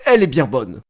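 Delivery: normal talking voice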